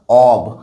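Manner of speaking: "of" held long, closing on a voiced b/v; pronunciation of "of". Shown as a demonstration. Teacher repeating how OF is pronounced, "of" with voiced ending. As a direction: say "of" as "awv", slowly. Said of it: "এটার উচ্চারণ".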